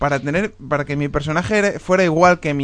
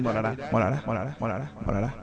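echo effect; 4 semitones lower, 9 dB quieter than usual. Shown as a demonstration. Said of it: "Probando efectos".

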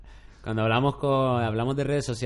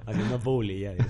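laughing. Real, not acted. Laughing through a joke.

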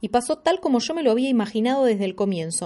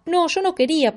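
high, louder protest; 9 semitones higher, 4 dB louder than usual. Acted. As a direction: push back hard. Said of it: "protesté". (protesta en voz alta)

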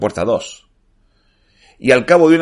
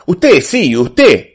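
louder, higher impatient clerk; 6 semitones higher, 5 dB louder, much slower than usual. A narrator's reading. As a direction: press it impatiently, punching the repeat. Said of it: "respondió el pelado impaciente".

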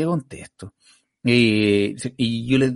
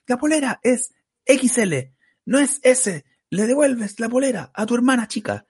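retold stage taunt; 10 semitones higher than usual. Retells teasing the heckler onstage. (burla en voz aguda)

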